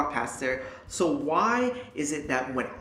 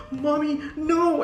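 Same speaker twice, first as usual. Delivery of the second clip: high, loud retold yell of protest; panicked yell; 14 semitones higher, 6 dB louder, slower than usual. Teen begging mom not to bring the snake in, high loud yell. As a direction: scream it much higher and louder, begging. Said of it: "I was like".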